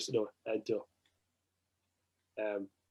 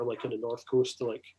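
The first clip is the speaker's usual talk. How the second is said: normal talking voice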